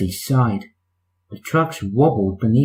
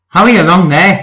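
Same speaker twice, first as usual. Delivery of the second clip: bossy call; 4 semitones higher, 5 dB louder than usual. Acted. Percy bossing trucks.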